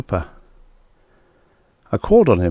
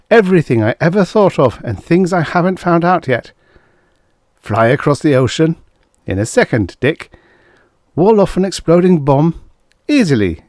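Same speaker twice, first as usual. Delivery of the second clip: higher, louder rush of pride; excited boast; 8 semitones higher, 5 dB louder than usual. A narrator's reading. Larry revealing his mind power to Dick, excitedly. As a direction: build to an eager, high-pitched boast.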